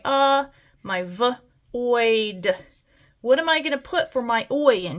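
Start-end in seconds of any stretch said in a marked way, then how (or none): 0.0-2.6 isolated "uh", short "v", then drawn-out "oid"; sounded out. Shown as a demonstration. Teacher breaking avoid into its sounds, sounded out. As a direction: separate "uh", "v" and "oid" with pauses. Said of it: "I've got my".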